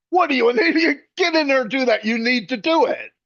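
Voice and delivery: hammed-up disbelief, pushing her to say yes; family scolding.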